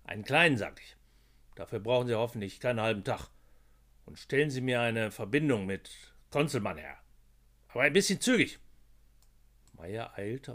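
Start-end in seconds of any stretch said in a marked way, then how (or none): 0.0-0.6 kleinen repeated, louder and punched; stress on kleinen. A narrator's reading. Boss repeats his order. 0.6-8.6 snorting gruffly. A narrator's reading. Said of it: "schnaubte Koch".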